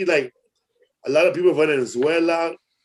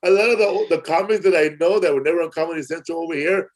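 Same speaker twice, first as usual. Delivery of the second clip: ordinary delivery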